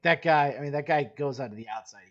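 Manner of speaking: loud, high call of that guy; suddenly animated, pointing him out; excited pointing out. Real, not acted. Coach pointing out the opponent on film, loud and excited.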